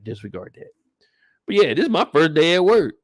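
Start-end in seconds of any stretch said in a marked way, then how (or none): 0.0-0.7 much softer, taking it back; softly waving off "Disregard that."; hushed correction. Real, not acted. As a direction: drop the voice to a near-mutter on "Disregard that.". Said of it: "did I say that out loud".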